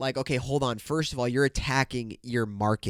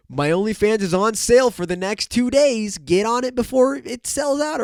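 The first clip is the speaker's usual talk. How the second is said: high, loud mock sales pitch; mocking promo, pitched well up; imitated OnlyFans promo; 8 semitones higher, 6 dB louder than usual. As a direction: plug it like an over-eager model. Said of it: "whatever the fuck they say".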